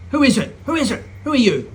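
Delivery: high, loud alarmed questions; startled shouts. Acted. President finds punks under table, startled shouting. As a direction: jump at the intruders.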